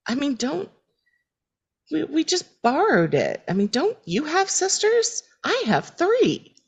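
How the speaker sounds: high, defensive mock-sister voice; annoying sisters. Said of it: "They are so annoying".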